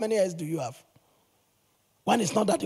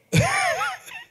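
high laughing shriek. Real, not acted. Laughs at his own joke.

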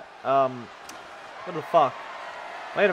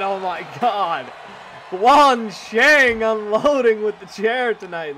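drawn out, louder announcer yell; excited shout; 3 semitones higher, 5 dB louder, slower than usual. Real, not acted. Commentator calling chair shots to the audience, slow hyped yell. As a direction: stretch it out and yell the call.

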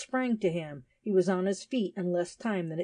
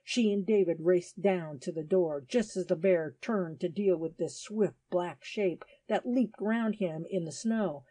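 ordinary delivery

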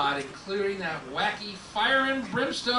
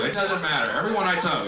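ordinary talk

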